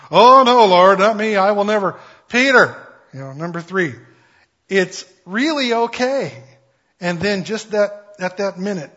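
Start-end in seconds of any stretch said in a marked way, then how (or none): 0.1-2.0 loud, pleading refusal, higher; loud protest. Acted. Peter voiced refusing a third time. 2.3-6.3 insistent Lord. Acted.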